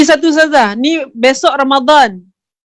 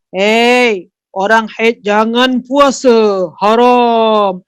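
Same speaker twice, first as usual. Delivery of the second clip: lower, slow, drawn-out decree; voicing a divine command; 4 semitones lower, much slower than usual. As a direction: lay down the prohibition slowly and low. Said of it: "Dan bila Allah cakap". (suara perintah yang rendah dan perlahan)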